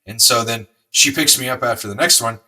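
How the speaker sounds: normal talking voice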